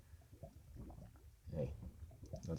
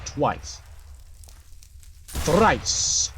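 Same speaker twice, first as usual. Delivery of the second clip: rising louder and higher to a triumphant "Thrice!"; gleeful build; 9 semitones higher, 11 dB louder than usual. Real, not acted. While shooting a creeper over and over, gleeful building shouts. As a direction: gloat more with each shot.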